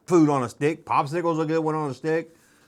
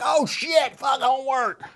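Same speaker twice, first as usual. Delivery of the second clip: put-on excited kid; 6 semitones higher than usual. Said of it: "I get so excited about all this stuff".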